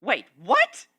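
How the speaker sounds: loud, shocked outburst; stunned exclamation. Acted. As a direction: blurt it out, caught off guard. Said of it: "she exclaimed, stunned".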